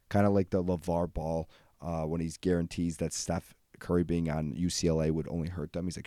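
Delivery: usual speaking voice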